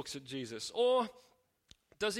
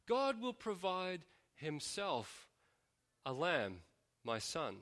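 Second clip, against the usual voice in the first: slow and soft; 6 dB quieter, slower than usual. Shown as a demonstration. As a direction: savour each phrase, quietly. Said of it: "Now just say that a couple of times".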